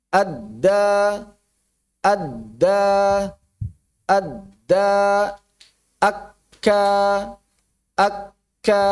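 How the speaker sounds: "adda" and "akka" stopped mid-word in silence; cut, held consonant. Shown as a demonstration. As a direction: block the doubled consonant in "adda" and "akka", then release. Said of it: "Contoh yang lain misalnya".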